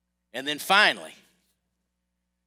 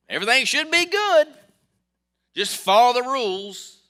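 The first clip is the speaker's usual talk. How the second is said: much higher, louder singsong of easy confidence; mock confident voice; 11 dB louder than usual. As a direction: parody the naive couple who thought all was fine. Mocking their own naive confidence.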